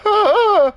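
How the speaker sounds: loud, very high startled wail; mock frightened cry. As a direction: imitate a neighbor losing his bravado. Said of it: "he kinda like".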